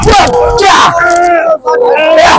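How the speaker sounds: fight cry. Acted.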